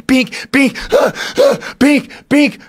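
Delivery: high-pitched imitated bumps and gasps; acted-out panic. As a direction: act out frantic knocking, gasping high between hits. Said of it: "screaming, freaking out".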